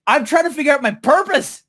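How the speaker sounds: high, indignant retold insistence; exasperated insistence. Speaker to laughing friend, exasperated insistence. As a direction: insist in mock outrage, pitch way up. Repeats answer, protesting the laughter.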